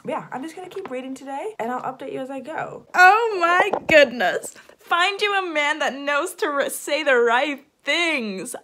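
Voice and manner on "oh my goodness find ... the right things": loud, high, swooning outburst; shriek-gush with long stretched words; excited gushing. Real, not acted. Reader gushing over a swoony book hero, loud and excited.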